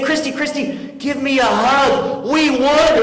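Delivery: high-pitched, giddy celebration; excited pleading. Retold or imitated. Fan running to his fiancée after the win, giddy excitement. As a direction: beg for a hug, bursting with victory.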